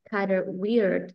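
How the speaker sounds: slow, linked kind of weird; slow teaching demo; slow model phrase. Shown as a demonstration. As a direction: say Kind of weird slowly, linking the words. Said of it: "I love the way she contrast the word kind of".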